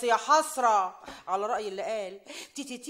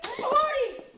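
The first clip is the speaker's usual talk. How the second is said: angry shooing; 10 semitones higher than usual. Acted. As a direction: drive the girl out of the house. Mother chasing daughter away.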